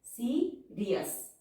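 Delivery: Serious drawn out slowly, the री and यस vowels apart; slow, vowels split apart. Shown as a demonstration. Teacher demonstrating the diphone to learners, slow and split. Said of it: "यहाँ पे डायफोन sound है".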